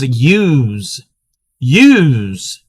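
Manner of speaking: YOUS said like "yooz"; Maryland accent. Shown as a demonstration. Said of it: "pronounce it yous".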